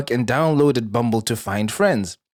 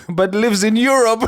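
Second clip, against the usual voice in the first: high, louder sarcastic jab; mocking; 8 semitones higher, 4 dB louder than usual. Real, not acted. Mocking the ex who distrusts Western food, high sarcastic jab. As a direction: poke fun at his hypocrisy.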